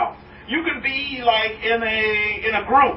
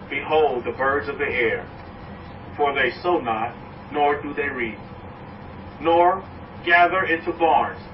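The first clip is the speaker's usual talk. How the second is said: lower, measured scripture voice; solemn scripture reading; 6 semitones lower, slower than usual. Acted. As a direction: read it low and reverent.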